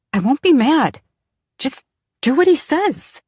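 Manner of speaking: high, loud, frantic plea; desperate pleading. Acted. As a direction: beg him to just obey. Daughter begging her father.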